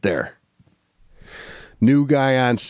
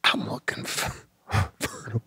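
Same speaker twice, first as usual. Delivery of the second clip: whispered aside; 2 semitones lower, 10 dB quieter than usual. Real, not acted.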